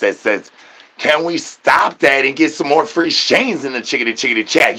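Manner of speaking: ordinary delivery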